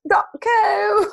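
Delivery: mock sign-off with CO squeaked up high; high, hammed-up lift on CO; exaggerated uptick. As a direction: send CO way up, playfully. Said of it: "uptick there".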